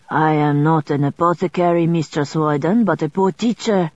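put-on light French accent on Mister Sweden; a scrivener greeting her in French-accented English; French accent, lightly done. Scrivener keeps his accent.

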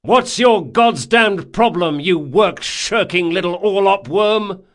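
loud, high, insulting demand; angry berating. A narrator's reading. Captain pinning a sailor to deck, angry shout. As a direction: tear into the shirker.

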